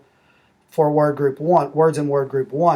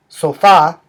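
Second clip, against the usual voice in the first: sofá with the second syllable stressed; stress on last syllable; 2 semitones higher, 8 dB louder than usual. Shown as a demonstration. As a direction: lean on the final á of sofá. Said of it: "the stress syllable is the second syllable".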